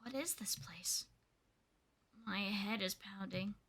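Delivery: soft, slow, groggy wake-up voice; voicing a confused Squirtle, hushed; dazed Squirtle. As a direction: mutter like you just came to.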